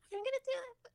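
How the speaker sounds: put-on very high excited kid squeal; little kid voice. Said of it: "the little kid's like".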